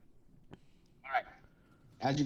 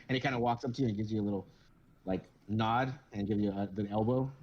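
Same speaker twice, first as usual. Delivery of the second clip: ordinary talk, nothing marked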